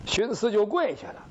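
usual speaking voice

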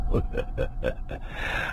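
low, obliging chuckle; a placating run of low laughs; ingratiating chuckle. A narrator's reading. Storyteller placates Madam Weng, ingratiating chuckle. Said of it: "急忙陪笑说道".

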